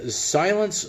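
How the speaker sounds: ordinary talk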